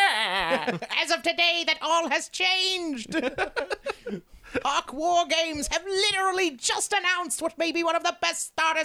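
high, slow villainous announcement. Pretend. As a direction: read the news like a gloating supervillain. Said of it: "The fucking villain voice. You do it all day".

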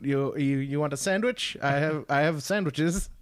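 a hint of a cosy, welcoming host; lightly voiced friendly del Toro. Mimicking del Toro inviting a friend, a light cosy voice. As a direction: coax them in, offering sandwiches. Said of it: "very heartwarming accent".

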